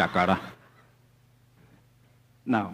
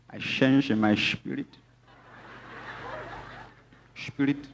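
lightly mimicked pious "shpirit"; mock super-spiritual believer; 3 semitones higher, 4 dB quieter than usual. Pastor mocking believers who won't use their minds, lightly put-on piety. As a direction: gently send up someone who only does "spirit". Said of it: "people who do spirit, spirit".

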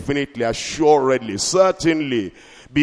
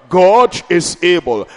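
rising preacher's declaration, louder; preaching; 2 semitones higher, 6 dB louder, slower than usual. Acted. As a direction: proclaim it to the congregation. Preaching refrain.

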